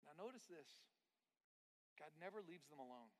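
ordinary talk, nothing marked

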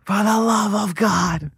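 put-on high, slow drunken heckler shout; hammed-up heckler yelling at a bombing comic; loud heckler. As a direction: bellow it from a back table at the comic. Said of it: "uh, loud".

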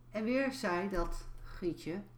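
normal talking voice

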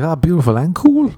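high, rising smitten delight; mock-smitten amazement. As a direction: marvel at your luck, pitch climbing. Acts out delight at a new partner.

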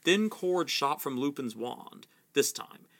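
ordinary delivery

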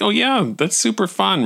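high-pitched sarcasm; mock enthusiasm. Sarcastic reply to Eric.

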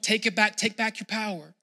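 ordinary talk, nothing marked

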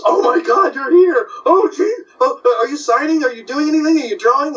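high-pitched, gushing questions; excited fans. Retold or imitated. Fans greeting the artist after long absence, excited. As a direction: gush in imitated fan amazement.

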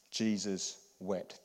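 very slow, soft: Jesus wept; hushed, slow recitation; slow solemn teaching. Shown as a demonstration. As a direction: let each word land, quietly. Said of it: "let me teach you this one".

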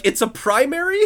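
baffled it's a primary?; incredulous question. Retold or imitated. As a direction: pitch shooting up in disbelief. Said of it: "I'm like".

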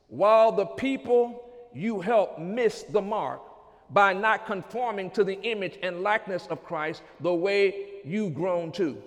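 ordinary delivery

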